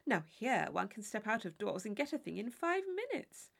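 a silly chaperone worried over her gown; fussy Mrs Allen. Acted. Mrs Allen chattering about shops.

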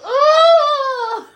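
mock scream. Tinker's hand grabbed, screams.

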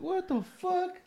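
high, softened squeal of disbelief; imitated startled outburst; mock shocked reaction. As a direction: act out recoiling at the sight, high and soft. Retold shock at seeing his nose.

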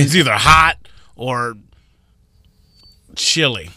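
blunt, aggressive delivery; belligerent. Real, not acted. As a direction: say it with a hard edge. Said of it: "Why did I say that so aggressively?".

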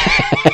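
short high laugh; amused high cackle. Real, not acted. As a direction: burst out laughing. Reacts to the player's curse.

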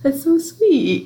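high, slow gush of sweetness; gushing aww. Retold or imitated. Reacting to a friends' love confession, high slow gushing coo. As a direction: coo it like melting over something cute. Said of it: "And I'm like".

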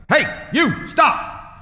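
sudden loud, high shout across the room; yelling. Retold or imitated. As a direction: yell to stop a man dead. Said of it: "he yells at the man".

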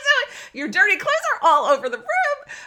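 high, loud laughing disbelief; laughing shout. Real, not acted. Mother to daughter about the book in the hamper, laughing loudly. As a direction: crack up as you point out the irony. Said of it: "And I'm laughing".

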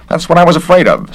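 the busybody official sizing him up; officious investigator. Acted. Garrett again.

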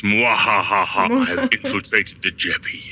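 slow evil villain laugh and boast; mad scientist. Retold or imitated. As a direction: gloat like a cartoon villain. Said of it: "And he says".

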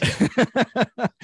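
bright laughing burst; high burst of laughter. Real, not acted. Laughs at own joke.

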